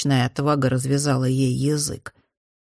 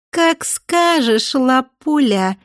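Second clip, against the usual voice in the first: high, slow, affected drawl; sassy drag queen; 8 semitones higher, 4 dB louder, much slower than usual. A narrator's reading. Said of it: "жеманно протянула Ада".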